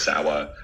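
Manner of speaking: "Sawa", a W replacing the R. Retold or imitated. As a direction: say "Sawa" like a kid who can't do R's. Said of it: "I couldn't do R's or L's".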